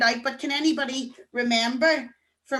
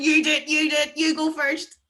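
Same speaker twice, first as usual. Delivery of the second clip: bickering couple; 4 semitones higher, 4 dB louder than usual. Retold or imitated. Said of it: "look at the couple going".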